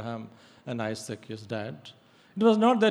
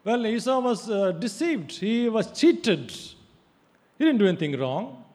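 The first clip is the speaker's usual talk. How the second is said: higher, louder, indignant protest; objector's protest; 7 semitones higher, 7 dB louder than usual. Pretend. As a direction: voice the listener's objection with outrage. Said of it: "Now you may think".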